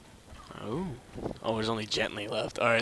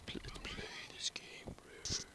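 whisper; 12 dB quieter than usual. Real, not acted.

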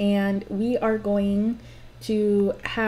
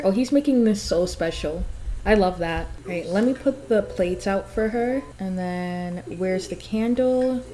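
ordinary talk, nothing marked

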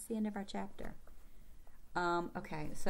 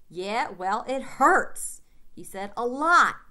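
loud, higher aching protest; pained loud complaint; 4 semitones higher, 11 dB louder, much slower than usual. Acted. Boy insisting to his sister his nose hurts, loud. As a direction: push it out loud, still hurting.

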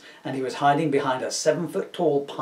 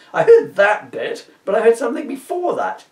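insisting hero; 8 semitones higher, 8 dB louder than usual. Acted.

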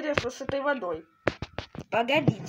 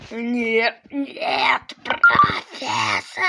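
despairing cry; much slower than usual. Acted. Professor faces death.

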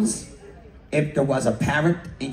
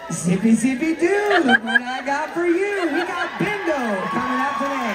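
high, giggling announcement in parrot voice; mock PA parrot; 10 semitones higher than usual. Host joking about a cruise announcement, high parrot voice. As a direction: announce it over the ship's speakers as a parrot. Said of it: "I'm gonna say".

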